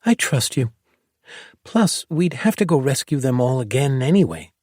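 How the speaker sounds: put-on high, light young girl's voice; a kid grinning as she agrees; smiling girl. A narrator's reading. Girl smiling as she lets him go. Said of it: "a smile forming on her lips".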